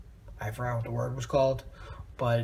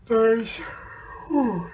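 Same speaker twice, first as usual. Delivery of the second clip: yawning; 8 semitones higher, 6 dB louder than usual. Real, not acted. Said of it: "Sorry for the yawn".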